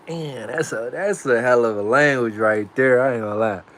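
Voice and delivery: usual speaking voice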